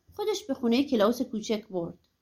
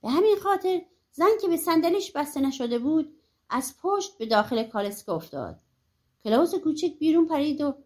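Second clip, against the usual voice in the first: ordinary delivery, nothing marked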